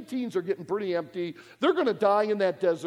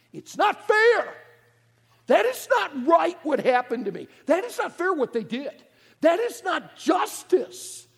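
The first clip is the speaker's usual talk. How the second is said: higher, louder hammed-up complaint; mock indignant protest; 8 semitones higher, 5 dB louder than usual. A wronged person protesting injustice, mock outrage. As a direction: cry out that it's unfair.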